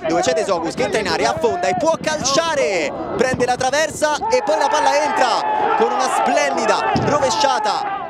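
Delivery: excited play-by-play, shouting the goals; breathless italian goal commentary. Acted. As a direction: call the match live, building to yells. Live match commentary.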